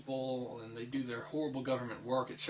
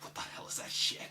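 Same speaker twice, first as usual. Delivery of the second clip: whispered; 3 dB quieter than usual. Retold or imitated. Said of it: "and I wasn't like".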